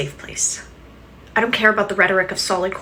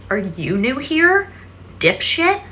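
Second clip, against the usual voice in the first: mocking retort; 5 semitones higher than usual. Real, not acted. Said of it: "mocking repeat of his line".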